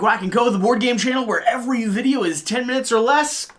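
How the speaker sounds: upbeat TV pitchman read; infomercial voice. Acted. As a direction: sell it like a late-night ad. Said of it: "I went to, like, a weird infomercial voice there".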